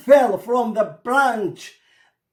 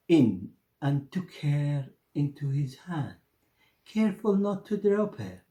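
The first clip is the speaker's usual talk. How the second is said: ordinary delivery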